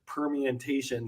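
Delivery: slowed "permutation": per-mi-en-ta-tion; every syllable sounded. Retold or imitated. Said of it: "saying every syllable".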